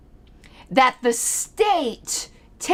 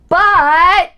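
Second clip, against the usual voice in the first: loud, high, drawn-out BUT; mock emphatic but; 7 semitones higher, 10 dB louder than usual. Critic quoting a tweet, mockingly emphatic. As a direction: pounce on the word with mock drama.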